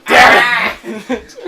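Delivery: high, loud, laughing curse. Real, not acted. Bad roll, cracking up.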